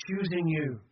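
ordinary talk, nothing marked

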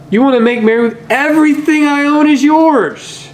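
an eager father's plea, high and urgent; pleading father. Acted. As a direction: beg the son to see all he already has. Father pleading with older son.

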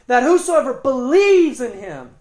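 "believes" pushed high and loud, "in him" falling away; stress on BELIEVES. Shown as a demonstration. Preacher showing what he wants you to see, "believes" stressed loudly. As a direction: hammer "believes", passing quickly over "whosoever". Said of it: "That's the fundamental definitional emphasis".

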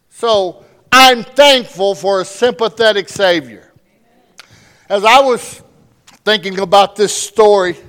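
loud, high, fervent sermon delivery; preaching at full force. Acted. Pastor driving home the point to his church, full-force preaching. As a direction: preach it out to the congregation.

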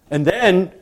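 ordinary delivery

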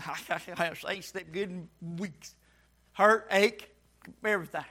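low, hushed, worn-out grumble; weary complaint. Acted. Preacher voicing a churchgoer who tosses and turns, weary low complaint. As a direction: play the sleepless sufferer, soft and low.

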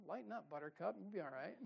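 soft, easy comfort; calm him down kindly; gentle reassurance. Retold or imitated. The Lord soothing the panicked preacher, soft. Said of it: "the Lord's like".

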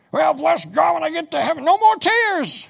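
lampoon the easy heaven talk; mocking pious saying. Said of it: "Where's that in the Bible?".

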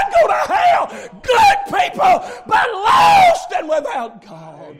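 high, loud revival preaching; full-throated, urgent sermon shout; preaching at full force. Real, not acted. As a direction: shout the warning at the sinner.

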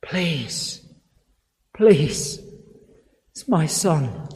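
a soft, desperate whisper of a plea; quiet begging. Retold or imitated. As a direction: beg under the breath for a dying son. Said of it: "He begs".

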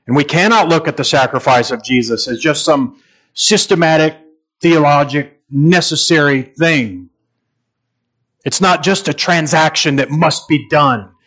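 a pulpit climax that swells to a cry; fervent preaching. Acted.

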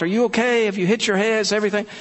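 high, anxious mom imitation; fretting questions through a locked door; worried mother.

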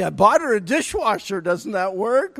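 defensive husband. Retold or imitated. Husband defending himself about the dishwasher, protesting. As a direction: protest like a husband who thinks he did enough. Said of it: "You say".

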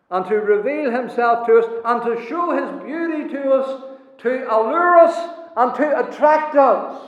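high, loud pulpit proclamation; full-force preaching. Acted. Preacher proclaiming to the congregation, loud and high. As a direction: preach it out to the whole hall.

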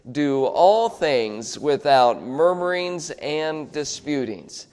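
slow, measured verse recitation; reciting aloud together. Shown as a demonstration. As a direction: lead the group through it slowly, word by word. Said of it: "say it out loud together".

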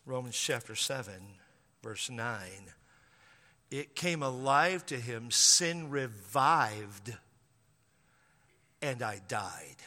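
low, slow, hushed scripture reading; solemn preaching. Acted. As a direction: drop low and slow for the verse.

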